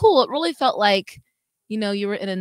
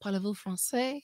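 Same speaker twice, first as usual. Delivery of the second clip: put-on French accent; 9 dB quieter than usual. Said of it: "Oh, see?".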